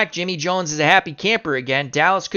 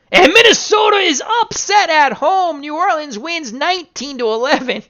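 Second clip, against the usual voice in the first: much higher, loud broadcaster shout on the upset; excited call; 11 semitones higher, 5 dB louder than usual. Acted. Sportscaster calling a shock upset, excited shout. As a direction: call the upset like it's live on air.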